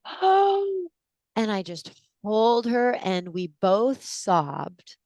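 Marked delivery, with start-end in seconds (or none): 0.0-0.9 tender realization. Retold or imitated.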